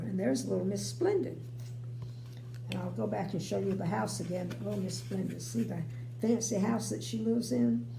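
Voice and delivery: usual speaking voice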